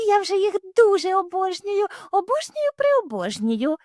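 very high, rising adoration; gushing. Acted. Little hare gushes about its new kittens. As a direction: gush over the kittens. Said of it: "сказало зайченя".

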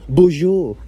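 put-on a playful French-accented bonjour; French accent, lightly mocking. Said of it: "we love the French accent".

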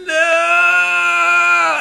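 high, loud drawn-out "No!"; slow-motion scream. Retold or imitated. Movie friend screaming in slow motion, drawn-out cry. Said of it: "That slow motion".

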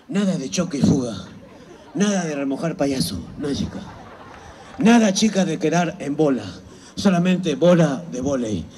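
put-on low, quiet old coach voice; mister Park voice. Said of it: "mister Park nos decía a las chicas".

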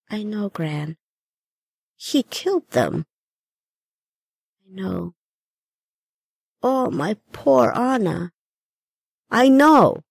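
a ghost remembering her murder in sorrow; grieving grandmother. Acted. Grandmother's ghost asks Jenny about the night she died, grieving. Gran's voice, grief.

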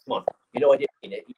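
ordinary delivery

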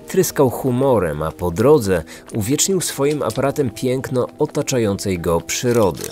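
plain reading voice

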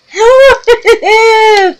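high shrieking laugh of delight; excited squealing laugh. Real, not acted. Crafter delighted by her glitter, squealing excited laugh. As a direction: burst out laughing, thrilled. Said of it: "Glitter gets me excited".

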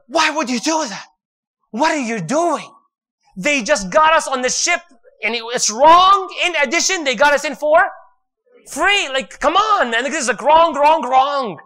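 high, loud indignant protest; outraged protest. Acted. Musa protests to Al-Khidr over the broken boat, high and loud outrage. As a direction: Musa won't let this slide, push it up and up.

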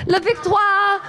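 high hammed-up French accent; grand faux-French victory cry; mock French accent. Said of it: "a little bit of French".

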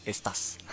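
Estás said unvoiced in a whisper; Estás whispered as one piece; whispered Spanish word. Shown as a demonstration. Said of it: "all together".

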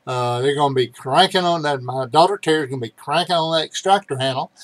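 usual speaking voice

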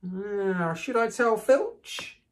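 high, slow, mock-innocent; saintly voice. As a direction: fake piety over sly glee. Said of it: "said Peeves in a saintly voice".

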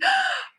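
sharp, high intake of breath; high gasp. A narrator's reading. Mother reaching her hurt son, high gasp. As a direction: gasp in alarm.

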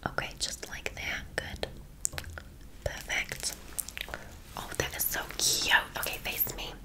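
soft, breath-only whisper; ASMR whisper. Acted. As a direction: whisper it close to the client. Whispered ASMR salon roleplay.

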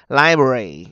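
Library with a loud, drawn-out LI; stress on first syllable. Shown as a demonstration. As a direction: punch LI at the start of Library. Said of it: "âm một nè". (nhấn mạnh âm một Library)

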